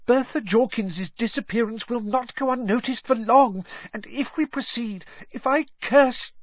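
gabbling out his fears in a panic; high, frantic servant pleading; panicked servant. Acted. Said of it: "panic in his voice now".

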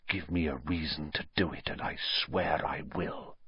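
very low, hushed threat; menacing whisper. A narrator's reading. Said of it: "he whispered".

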